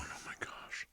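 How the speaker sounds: hushed whisper under his breath; whispered aside. Retold or imitated. Husband whispering in shock at the car's price. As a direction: breathe it out so only you hear.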